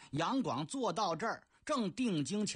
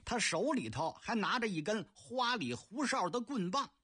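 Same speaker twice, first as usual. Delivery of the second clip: plain reading voice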